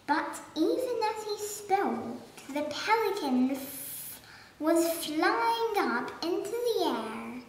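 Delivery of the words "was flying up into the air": usual speaking voice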